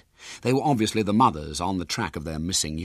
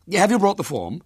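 high, loud, prim busybody voice; a bossy lady interrogating the boy; lifted, carrying voice of a nosy woman; officious lady; 11 semitones higher, 5 dB louder than usual. Acted. Officious woman questioning William.

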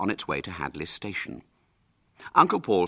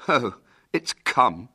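put-on slow, bitter boy; 4 semitones higher, 5 dB louder, slower than usual. William telling the Outlaws about the lost knife, bitterly. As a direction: drag it out bitterly. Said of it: "said William bitterly".

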